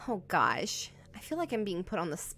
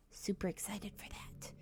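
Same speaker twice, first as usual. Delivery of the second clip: whisper; 11 dB quieter than usual. Real, not acted. Aside about next stream.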